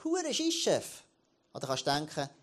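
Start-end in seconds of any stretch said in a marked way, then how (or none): 0.0-1.0 mock curse. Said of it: "Und jetzt kannst du sagen".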